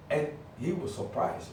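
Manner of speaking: normal talking voice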